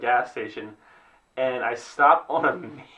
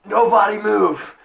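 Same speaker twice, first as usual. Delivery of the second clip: higher, louder, slow shout like a traffic cop; mock shout; 9 semitones higher, 7 dB louder, slower than usual. Helper stops traffic on the avenue, mock shout. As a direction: halt the whole street. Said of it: "jumps out in the middle".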